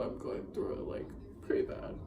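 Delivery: whispered, almost no voice; breathy admission of heartbreak; hushed confession. Real, not acted. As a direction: let it drop to a whisper. Admitting she is hurting.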